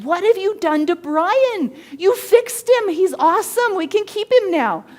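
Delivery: high, loud, delighted chorus of coworkers; excited coworkers. Retold or imitated. Coworkers raving to the husband about Brian, high and loud. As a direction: gush about how great he is now.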